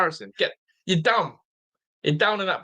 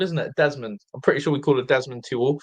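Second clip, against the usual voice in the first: normal talking voice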